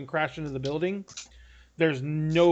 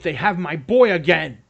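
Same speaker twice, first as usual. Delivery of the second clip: louder, strained-with-urgency appeal; urgent appeal; 7 dB louder than usual. Acted. Father begs party to follow the skiff, urgent. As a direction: push it out louder, as a desperate order. Lord pleads for son's rescue.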